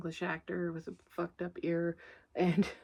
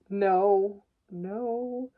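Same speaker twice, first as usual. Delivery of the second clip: sudden no; 9 dB louder than usual. Real, not acted. Stopping herself from a joke.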